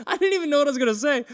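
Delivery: fast, loud and laughing. Real, not acted. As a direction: rush it out through laughter. Laughing while telling the story.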